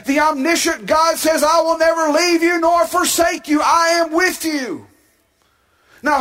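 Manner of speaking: fervent pulpit crescendo; preaching at full force. Acted.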